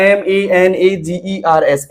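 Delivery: slow, one letter at a time; calm spelling demonstration. Shown as a demonstration. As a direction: read each letter slowly and separately. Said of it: "आपको ऐसे पढ़ना है".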